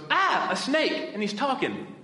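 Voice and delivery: high, loud, slowed-down astonishment; hammed-up startled Eve; mock amazed gasp. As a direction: gape at a talking snake. Preacher acts out Eve seeing snake.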